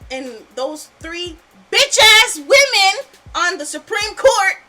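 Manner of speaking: real anger. Real, not acted. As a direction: spit out the insult, loud and furious. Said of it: "I'm mad".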